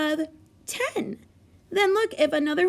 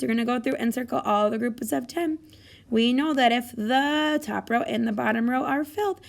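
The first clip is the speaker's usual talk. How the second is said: ordinary delivery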